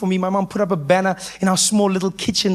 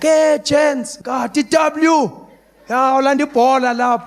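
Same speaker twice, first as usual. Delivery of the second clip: high, drawn-out excited greeting; calling to friends; 6 semitones higher, slower than usual. Acted. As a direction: holler to the gang as an eager kid. Kid calls out to his friends.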